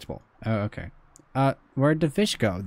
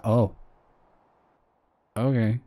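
ordinary talk

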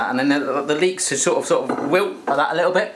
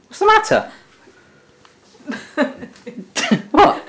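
high teasing question; 11 semitones higher than usual. Real, not acted. Teasing mum who is laughing.

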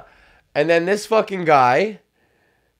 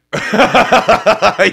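bursting laugh, higher and louder; 6 semitones higher, 7 dB louder than usual. Real, not acted. Laughing at his leather daddy joke. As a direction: crack up loudly.

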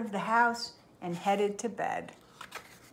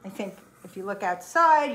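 ordinary delivery, nothing marked

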